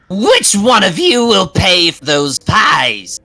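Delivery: slow, high, loud witch accusation; threatening witch. Acted. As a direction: finger in their face. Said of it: "and she says".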